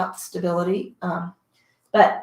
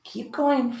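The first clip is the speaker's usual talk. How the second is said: normal talking voice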